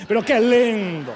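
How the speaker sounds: loud, drawn-out mock delight; hammed-up funeral cheer; imitated jubilant pastor. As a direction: boom it out slowly, savouring the words.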